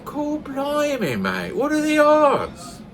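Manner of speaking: high, slow, amazed Newfoundland voice; amazed Newfoundlander. Acted. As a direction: gasp it out in disbelief, Newfoundland style. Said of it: "a newfie joke".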